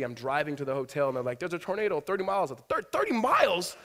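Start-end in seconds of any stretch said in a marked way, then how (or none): 1.4-2.5 higher, louder urgent warning; alarmed warning. Retold or imitated. Retold storm warning, alarmed. 2.7-3.8 much higher, much louder shocked cry; stunned echo of the tornado warning; shocked disbelief. Retold or imitated.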